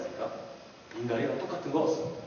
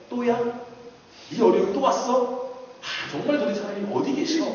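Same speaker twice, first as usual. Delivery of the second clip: higher, exasperated grumble; complaining; 7 semitones higher than usual. Pretend. Believer grumbling at God about hardship, exasperated. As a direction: whine at God in frustration. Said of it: "이렇게 말할 태도인지".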